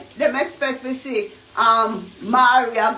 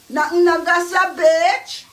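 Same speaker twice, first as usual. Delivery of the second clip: sassy retort; 5 semitones higher, 4 dB louder than usual. Real, not acted.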